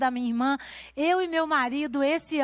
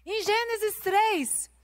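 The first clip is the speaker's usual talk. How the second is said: high, pressing preacher's voice; pregação inflamada; 8 semitones higher than usual. Acted. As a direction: preach it out to the women, rising. Opening sermon point, raised voice. (pregação em voz alta e intensa)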